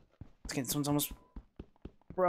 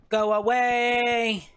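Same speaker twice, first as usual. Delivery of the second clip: slow, higher and louder, every vowel stretched; drawn-out yell; 5 semitones higher, 4 dB louder, much slower than usual. Real, not acted. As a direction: wail it out at the mob, dragging each word. Stuck in web, mob near.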